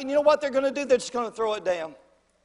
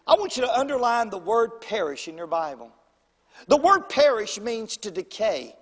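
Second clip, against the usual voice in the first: ordinary delivery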